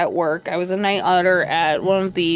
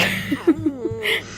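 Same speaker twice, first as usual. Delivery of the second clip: laugh; 7 semitones higher than usual. Real, not acted. Laughing at own situation.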